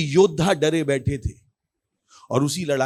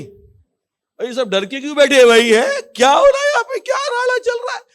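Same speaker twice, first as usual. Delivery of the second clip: high, climbing yell; loud brash questioning; 11 semitones higher, 6 dB louder than usual. Retold or imitated. Boy asking why all sit scared, loud high shouting. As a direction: holler it with no care for hiding. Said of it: "इतनी जोर से क्यों बोल रहा है".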